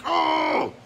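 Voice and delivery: a sudden, very high "Oh!"; mock outraged gasp. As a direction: yelp in shock at a bad review. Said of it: "I'm like".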